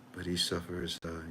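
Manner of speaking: normal talking voice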